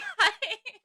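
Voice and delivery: high burst of laughter. Real, not acted. Laugh after mock outburst.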